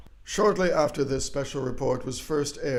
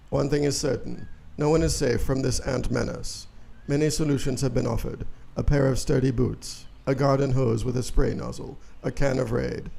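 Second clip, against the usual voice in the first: ordinary talk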